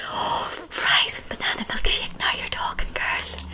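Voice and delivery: ordinary delivery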